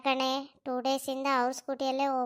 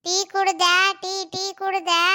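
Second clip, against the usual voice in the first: ಟೀ ಕುಡ್ದೆ called louder and higher; tea ಕುಡ್ದೆ repeated loud, higher, to be heard; 6 semitones higher, 5 dB louder than usual. Acted. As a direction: shout the question again for a deaf listener. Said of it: "ಕೇಳಿಸ್ವಲ್ದು".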